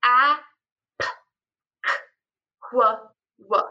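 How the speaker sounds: each sound said alone, clipped apart with pauses; isolated speech sounds. Shown as a demonstration. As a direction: sound out each phoneme separately. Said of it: "You can just give them".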